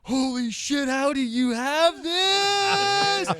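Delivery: overjoyed almost crying; ecstatic disbelief. Retold or imitated. Stefan getting his phone back, ecstatic high cry. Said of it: "he was like".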